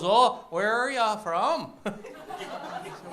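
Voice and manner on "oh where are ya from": slow, high North Dakota drawl; mock North Dakota girl asking sweetly; lightly voiced North Dakota local; North Dakota accent. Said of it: "girl there goes".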